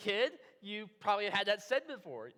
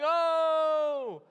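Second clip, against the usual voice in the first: loud, high, drawn-out "ohhh!"; crowd "oh!"; 7 semitones higher, 12 dB louder than usual. Acted. Crowd reacting to the ultimatum, loud long "oh!". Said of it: "picture the crowd in the room going".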